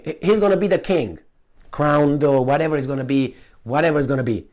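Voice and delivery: normal talking voice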